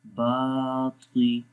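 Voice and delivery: बाती with the बा held long; slow बाती, long aa in बा; lengthened vowel. Shown as a demonstration. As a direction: stretch the first vowel of बाती. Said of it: "बा को लंबा करेंगे".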